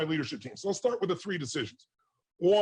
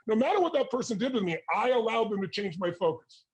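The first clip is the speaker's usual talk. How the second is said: ordinary talk, nothing marked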